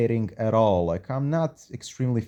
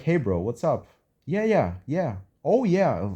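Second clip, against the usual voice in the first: mock chatty back-and-forth, rising higher on the replies; imitating self-talk; 4 semitones higher than usual. Imitating a patient talking intensely with himself, lightly voiced. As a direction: act out someone fluently answering himself. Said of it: "he was talking in such a fluid way".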